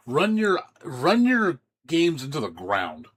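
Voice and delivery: loud and slow, mock-furious; hammed-up angry rant; retold outburst. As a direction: hammer each word of it out. Ranting quote of the game's director.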